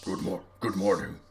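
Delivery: lightly deepened cleric greeting; a hint of a deep voice; deep-voiced cleric. Cleric greets the party, lightly deepened voice. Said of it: "really deep voice".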